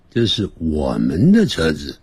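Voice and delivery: normal talking voice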